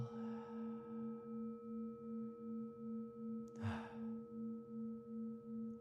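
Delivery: long whispery release sigh; long exhale. Acted. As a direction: breathe all the way out. Ending breath of meditation.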